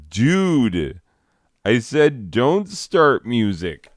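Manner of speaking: fed-up protest ending in a grunt; exasperated. Real, not acted. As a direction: tell off the cat, losing patience. Scolding cat for starting music.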